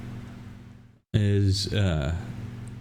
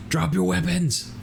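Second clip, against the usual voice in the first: acted yell; 10 semitones higher than usual. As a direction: call out an order in a much higher voice. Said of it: "yelling for everybody to put down".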